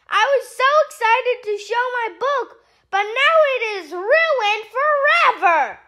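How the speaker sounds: high panicked distress call; high frantic mayday panic. Acted. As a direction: sound the alarm in a panic. Panicking over ripping page.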